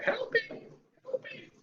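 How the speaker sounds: very high, small squeaky cry for help; tiny pleading voice. Acted. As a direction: squeak it like a tiny helpless creature. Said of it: "there's Mel saying".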